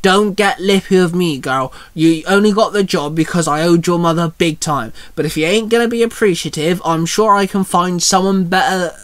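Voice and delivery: ordinary delivery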